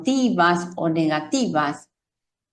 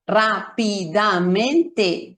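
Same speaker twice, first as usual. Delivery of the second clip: "rápidamente" very slowly, every syllable equally long; much slower than usual. Shown as a demonstration. Teacher showing learners equal syllable length, "rápidamente" syllable by syllable. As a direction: break "rápidamente" into even, fully sounded syllables: rá-pi-da-men-te. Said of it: "y tienen una duración similar".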